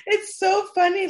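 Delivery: high and loud, laughing till tearful; laughing hard. Real, not acted. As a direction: crack up, voice squeaking. Laughing hard at friend's story.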